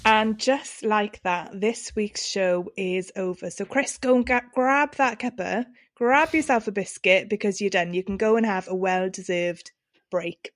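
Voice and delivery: upbeat show sign-off voice; podcast outro. Acted. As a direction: wrap up the episode like a host. Podcast sign-off over music.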